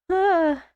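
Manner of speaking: short, high, pained gasp; a small sharp gasp of hurt; little pained cry. Retold or imitated. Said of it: "Ellie let out her little".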